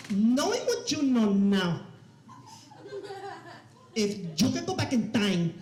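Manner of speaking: put-on slow, deliberate Cuban mom accent. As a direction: put on mom's thick Cuban accent, pausing for effect. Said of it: "I'll do the accent for you guys".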